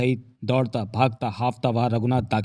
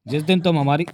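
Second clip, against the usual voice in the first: higher, louder first take; 4 semitones higher, 4 dB louder than usual. A narrator's reading. Narrator restarts the line.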